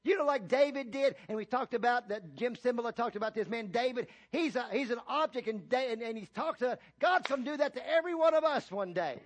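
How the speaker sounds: full-force preaching. Real, not acted. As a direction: preach it out, stretching key words.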